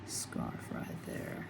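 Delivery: whisper. Real, not acted. Trailing aside while painting.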